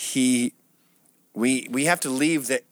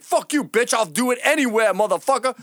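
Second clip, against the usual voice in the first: imitating angry guy; 11 semitones higher, 4 dB louder than usual. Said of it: "He's like".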